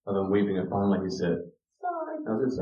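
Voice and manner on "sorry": put-on tiny toddler sorry. Son finally apologizes after crying.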